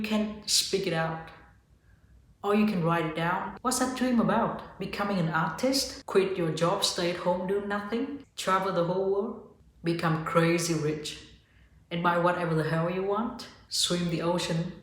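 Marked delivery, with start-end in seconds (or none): none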